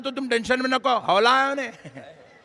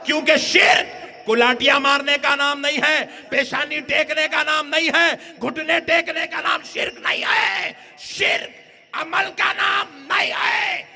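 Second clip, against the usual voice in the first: fiery preaching; 4 semitones higher than usual. Acted. Preaching at full force.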